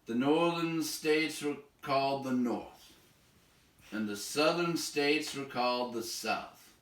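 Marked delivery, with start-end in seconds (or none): none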